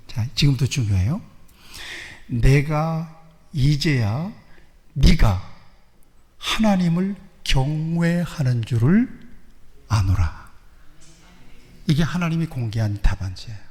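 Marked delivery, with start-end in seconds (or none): 2.3-10.5 slow, weighty reading of God's words; slow solemn voice of God; solemn scripture reading. Acted. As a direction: lay each phrase down slowly, with long pauses. Voices God's verdict slowly.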